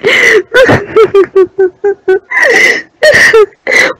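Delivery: a long high sobbing wail; anguished wail. Acted. Mother wailing at husband over lost child. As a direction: break into a loud cry of despair. Mother discovers daughter missing.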